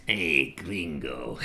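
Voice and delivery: low, very slow Mexican growl; mock Mexican accent. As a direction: drag it out low and soft, Mexican-style. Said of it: "What else do they say in Mexican".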